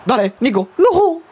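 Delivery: high urging call breaking into a loud NO; very high, anguished NO; urgent cry, then dismay. Real, not acted. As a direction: spur the player on, then yell in dismay.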